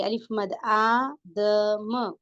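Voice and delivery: name the letter, then long aa in आदम; slow आदम with separate da and ma; madd practice. Shown as a demonstration. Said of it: "अलिफ मद आ की आवाज".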